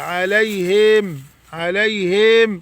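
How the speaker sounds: عليهم slowly, with an extra ي added after the ه; exaggerated wrong. Shown as a demonstration. Teacher mocking the over-stretched wrong form, slowly. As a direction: overdo the ه in عليهم into a long ي. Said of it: "يروح مزودين ياء".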